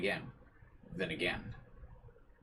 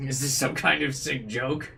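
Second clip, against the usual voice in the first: louder, higher disbelieving cry; loud outraged line; 6 semitones higher, 7 dB louder than usual. Acted. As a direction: demand it in shocked fury. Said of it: "Was that loud enough for you guys to hear?".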